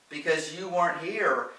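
loud retort. A narrator's reading. Lorraine answers Gordon on the phone, loud retort. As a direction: fire back loudly. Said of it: "she answered loudly".

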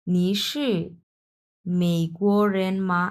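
slow নি শি মেইকোরেন মা with মা drawn out; Chinese question reading. Shown as a demonstration. Teacher models the Mandarin question form for students, slow careful reading. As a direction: stretch মেইকোরেন মা slowly as a question. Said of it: "তাহলে এখানে হবে".

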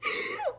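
squeaky high giggle. Real, not acted. Giggling at her childish crush list. Laugh after crush categories.

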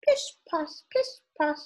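high little footstep sounds; high, crisply separated cat paws; dainty cat steps. Acted. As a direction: tiptoe it out in a high voice. Acting out a cat's footsteps.

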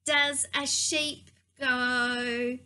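ordinary talk, nothing marked

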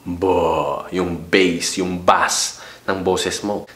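low and slow; slow bass demo of a deep voice. Shown as a demonstration. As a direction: sink into the bass of your voice. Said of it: "Yung mababang boses".

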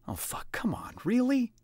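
hushed groan then high pitched really; Adam protesting to his grinning bunkmate. A narrator's reading. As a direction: protest in embarrassment. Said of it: "he protested".

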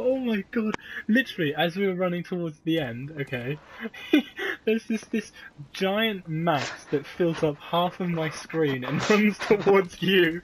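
loud, high, slow with laughter; cracking up while retelling; excited laughing. Real, not acted. Retelling monster chase laughing.

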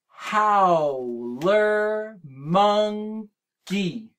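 howler monkey in four slow clapped beats, how-ler mon-key; chunked into syllables. Shown as a demonstration. Teacher chunking the animal name with the kids, slow. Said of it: "let's try to do howler monkey".

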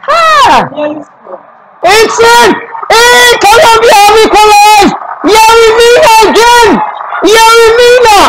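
wild, high-pitched goal celebration; ecstatic goal call. Real, not acted. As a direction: erupt at a last-gasp equalizer. Live call of late equalizer.

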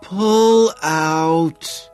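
high, loud, drawn-out groan. A narrator's reading. Woman struggling under her lover, groaning. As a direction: groan it out, stretched and straining. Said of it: "she groans".

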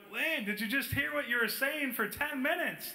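high-pitched retold disbelief; incredulous. Confronting a friend who remembers nothing, incredulous. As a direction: challenge him in acted astonishment.

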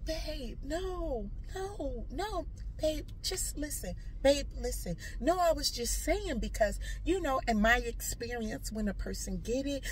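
soft, coaxing explanation; placating pleading. Retold or imitated. Wife explaining herself to angry partner, softly pleading. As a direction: plead gently to be heard.

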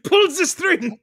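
put-on high, loud accented command; mock director yell. Host playing the screaming director, high accented yell. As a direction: yell it as a hammed-up foreign director.

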